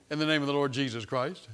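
ordinary talk, nothing marked

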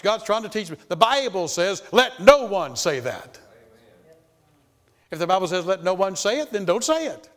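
loud, insistent pulpit delivery; full-force preaching. Acted. Preacher exhorting congregation on temptation, loud pulpit preaching. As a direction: drive the scripture home.

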